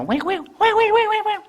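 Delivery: very high squeaky osprey cries; bird whine imitation. Shown as a demonstration. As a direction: squeal it like a whining seabird. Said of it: "very high-pitched".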